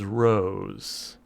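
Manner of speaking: a quiet, knowing greeting; smiling spirit. Acted. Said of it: "she smiles down at you and goes".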